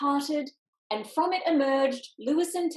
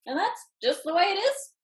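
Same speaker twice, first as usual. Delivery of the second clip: Winky voice; 8 semitones higher than usual. A narrator's reading. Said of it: "said One-Eyed Winky".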